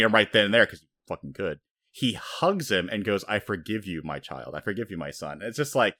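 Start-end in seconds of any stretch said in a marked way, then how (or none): 3.2-5.4 tender forgiveness. Retold or imitated. Whitebeard hugging his stabbed-in-the-back son, a touch of soft tenderness. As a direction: lower and softer, gentle father.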